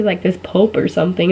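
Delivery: normal talking voice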